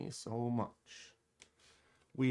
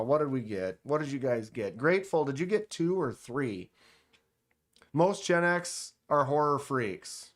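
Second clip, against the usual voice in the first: ordinary talk, nothing marked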